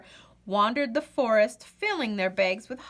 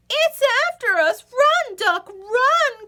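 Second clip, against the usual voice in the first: much higher, loud panicked shout; panicked cry; 14 semitones higher, 8 dB louder than usual. Acted. Goose panics at the monster, high loud cry. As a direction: scream for Duck to run. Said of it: "cried Goose".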